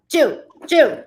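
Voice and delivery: June called out high and loud, twice; loud calling. Retold or imitated. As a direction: relay a name being yelled at you.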